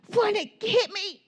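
put-on high little girl's voice daring him; little sister taunt. Toddler sister daring her brother to hit her, high and squeaky. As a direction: squeak it like a three-year-old egging him on.

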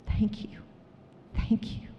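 imitating a hushed, whispered thank-you; soft whisper, acting out her reply. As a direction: mouth it softly, almost without voice. Retells woman's hushed thanks.

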